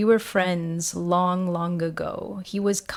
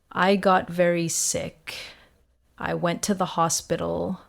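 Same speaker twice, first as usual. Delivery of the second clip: usual speaking voice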